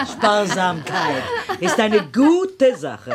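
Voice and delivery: slow, high, laughing through it. Acted. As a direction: Duchess amused by talk of her thrift, laughing. Duchess laughing about thrift.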